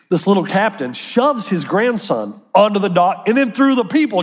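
ordinary delivery

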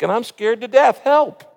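frightened plea climbing to a cry; desperate cry for help. Shown as a demonstration. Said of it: "You can lament out of fear".

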